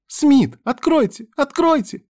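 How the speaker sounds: very high, loud begging cries; panicked girl's screamed plea; frantic pleading. A narrator's reading. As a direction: beg desperately through a locked hatch. Girl pleads at locked hatch.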